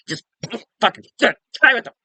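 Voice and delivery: high, loud ranting yell; angry outburst. Acted. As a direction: blow up at him through the screen. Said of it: "it just angers me".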